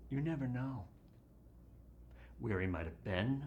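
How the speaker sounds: ordinary delivery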